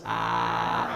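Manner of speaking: low croaky "Ah" like a didgeridoo drone. Shown as a demonstration. Teaching the crowd a fake didgeridoo drone, croaky. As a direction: croak "Ah" from the throat. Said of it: "Can everyone do croaky voice?".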